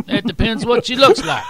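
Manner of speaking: high, loud imitated Texan; Texas accent. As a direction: deliver it as a hammed-up Texan punchline. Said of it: "He says".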